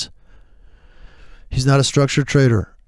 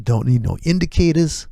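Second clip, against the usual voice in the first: ordinary talk, nothing marked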